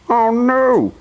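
high loud gasped oh no; horrified at his carrot nose; gasping. Acted. Mister Mean finding his nose a carrot, high loud gasp. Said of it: "he gasped".

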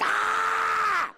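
very high, sustained scream; hammed-up shriek as the wax rips off; mock pain scream. Client has first strip pulled, high mock scream.

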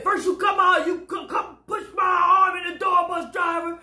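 high shouted accusation; retold accusation. Woman accusing the driver of hurting her arm, mock shout. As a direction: yell at the driver.

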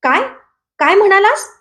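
high, shocked questioning; startled cry. A narrator's reading. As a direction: blurt it out in alarm, pitch jumping up. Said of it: "धस्स दिशी दचकून विसू ओरडला". (दचकून विचारलेला प्रश्न)

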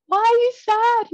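high, loud imitation of a baffled surgeon; concerned surprise. Surgeon asking his crying patient after surgery, high concerned surprise.

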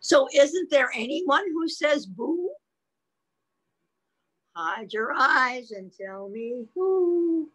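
put-on suspenseful read-aloud for a child; picture-book reading. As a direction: read it playfully, stretching the last words.

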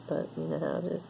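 ordinary talk, nothing marked

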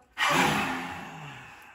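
loud breathy roar falling away; lion's breath exhale. Shown as a demonstration. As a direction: let the last lion breath roar out. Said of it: "Let's do three together".

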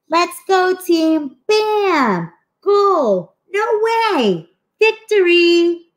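high, singsong cheers, slowing on victory; excited cheering. Acted. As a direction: cheer the team on and celebrate the goal. Team scores winning goal.

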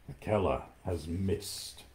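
slow, low and soft, a big cat's murmur; low panther warning. Acted. Panther Bagheera warns the boy, low and slow. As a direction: let the panther breathe the news out darkly. Panther brings bad news.

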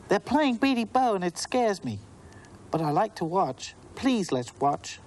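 put-on high little girl, timid and pleading; a four-year-old asking to stay and watch. Natalie begging Abigail to watch the game, high child voice.

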